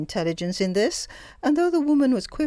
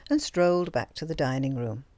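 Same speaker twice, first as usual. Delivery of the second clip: ordinary delivery, nothing marked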